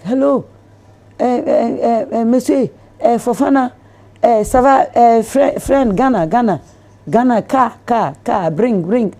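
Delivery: high, loud halting mock French; imitating his broken French. Businessman calling Côte d'Ivoire, halting mock French. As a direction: stammer out broken French to the operator. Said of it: "So he was like".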